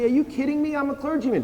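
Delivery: a pleading clergyman protesting at heaven's gate, high and indignant. Retold or imitated. Preacher imitating himself protesting to Saint Peter, voice jumped high. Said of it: "I say".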